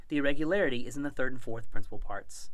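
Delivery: usual speaking voice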